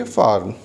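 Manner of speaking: फार्म with the R sounded; फार्म, clear R before the M; American pronunciation. Shown as a demonstration. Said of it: "American accent में हम ऐसे ही बोलेंगे".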